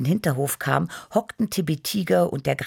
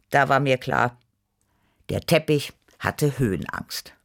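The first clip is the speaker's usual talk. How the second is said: ordinary delivery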